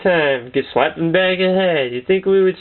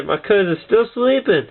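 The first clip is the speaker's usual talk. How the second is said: normal talking voice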